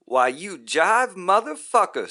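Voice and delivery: high, loud enraged scream; furious scream. A narrator's reading. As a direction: scream the insult straight at the guru. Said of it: "screams Gimme Some Roy".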